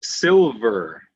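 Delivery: "silver" slowly sounded out with a firm v; emphasised middle V. Shown as a demonstration. Teacher giving a middle V example, deliberate v. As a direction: articulate the v inside "silver". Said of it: "Using V in the middle of a word".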